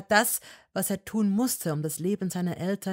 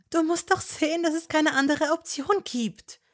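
put-on higher young woman's voice, pleading and firm; Hermine voice; 11 semitones higher, 6 dB louder than usual. A narrator's reading. Hermine to Malfoy, higher and insistent. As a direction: argue with him, voice raised high.

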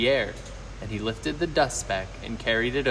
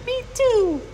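very high, loud little squeak; young kangaroo; 5 dB louder than usual. A narrator's reading. Young kangaroo echoing mother from the pouch, squeaky chime-in. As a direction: pipe up like a tiny copycat. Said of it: "the young kangaroo in her pouch said".

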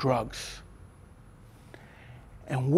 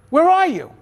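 alarmed dad; 10 semitones higher, 7 dB louder than usual. Retold or imitated. Father alarmed on phone.

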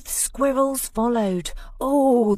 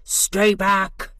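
louder warning bark; shouting; 5 dB louder than usual. Acted. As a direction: hold him off with a shout. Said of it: "shouted Bruce".

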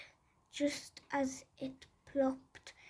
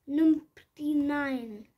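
squeaky cry; 2 semitones higher, 8 dB louder, slower than usual. Acted. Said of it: "cried Squeak".

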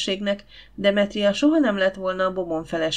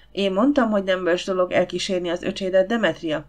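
usual speaking voice

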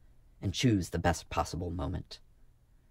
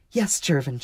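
high, louder, delighted answer; joyful; 7 semitones higher, 7 dB louder than usual. A narrator's reading. Marka confirming her priesthood to Jervin, joyful. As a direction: answer with open joy. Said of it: "she answered joyfully".

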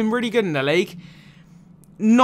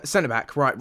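ordinary delivery

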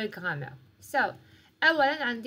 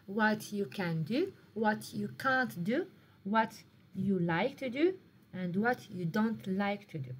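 slow dictation; much slower than usual. Shown as a demonstration. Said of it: "teacher dictating sentence patterns".

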